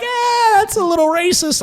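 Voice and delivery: high, slow, strained voice; mock uneasy bystander. Bystander reacting to his Southern yell, mock unease. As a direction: squeak out a hesitant objection, imitating him.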